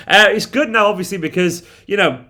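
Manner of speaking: ordinary talk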